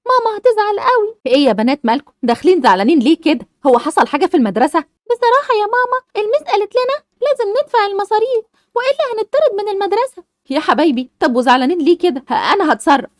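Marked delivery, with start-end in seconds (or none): 0.1-1.1 put-on high worried girls' voices; fretting schoolgirls; childlike worry; young daughters. Daughters worry about fees. 4.8-10.2 put-on high girl's voice, hesitant; a daughter admitting trouble; childlike hesitant confession; young daughter.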